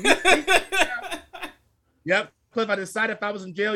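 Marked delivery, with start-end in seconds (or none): 0.0-1.1 high, laughing, slow taunt; laughing tease. Real, not acted. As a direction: crack up while taunting. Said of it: "repeat, laughing higher".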